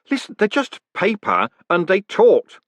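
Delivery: exasperated and scared; agitated. A narrator's reading. Moist arguing with the golem, agitated voice.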